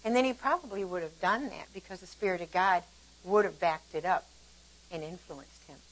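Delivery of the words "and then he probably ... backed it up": ordinary talk, nothing marked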